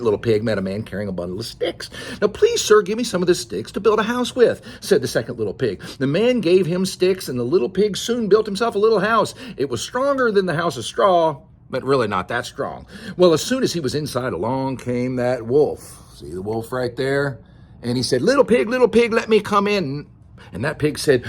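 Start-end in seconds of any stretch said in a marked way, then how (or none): 2.2-4.6 higher, politely begging pig; lightly voiced pig asking nicely; pleading little pig. Second pig begs for sticks. 18.2-20.1 high, loud coaxing wolf call. Acted. Wolf calls at second pig's door.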